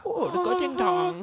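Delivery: put-on high, loud, drawn-out joke Polish accent; mock Polish accent. Showing friends the joke Polish accent, loud and high. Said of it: "That's the accent".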